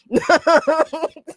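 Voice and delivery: short high burst of laughter. Real, not acted. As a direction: cracks up laughing. Laughs after the bit.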